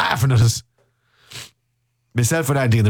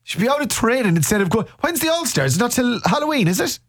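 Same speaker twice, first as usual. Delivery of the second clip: higher, hammed-up outrage at the lads; mock fuming rant; 10 semitones higher than usual. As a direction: rant like a scandalised fan, pitched up. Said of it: "we're absolutely fuming".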